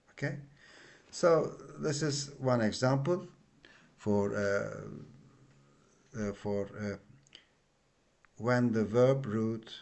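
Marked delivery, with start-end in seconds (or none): none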